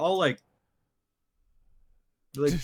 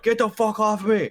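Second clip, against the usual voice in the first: higher, louder irritated outburst; annoyed mock yell; 8 semitones higher, 6 dB louder than usual. Orangutan to one pestering its sleep, annoyed mock yell. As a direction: snap it out as the pestered ape. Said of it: "the other one was like".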